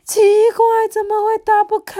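high, loud whine over a stuck lid; high-pitched exasperated puzzlement; fretting mother. A narrator's reading. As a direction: voice a mother in a high, fretful wail.

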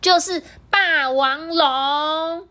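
high, drawn out, savoring the name; slow proud child voice. Acted. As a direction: announce it slowly like a big secret. Baby names the strongest one.

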